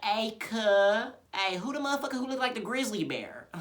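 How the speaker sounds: troll voice. Acted. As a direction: taunt the lobby in a goofy troll voice. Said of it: "I would always put on my troll voice".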